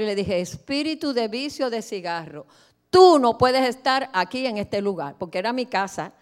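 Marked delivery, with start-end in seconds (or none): 0.4-5.2 a loud rebuke cast at a spirit; commanding rebuke. Retold or imitated. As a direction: order the spirit out, voice rising to a shout.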